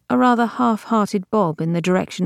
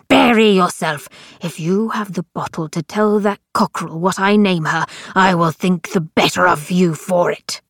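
put-on ranting old man; 3 dB louder than usual. A narrator's reading. Crux ranting at Ortus.

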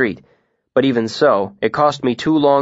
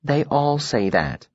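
slow, tender and hushed; gentle, drawn-out sympathy; 3 semitones higher, 4 dB quieter, slower than usual. A narrator's reading. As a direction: console them gently, taking your time. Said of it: "her voice gentle".

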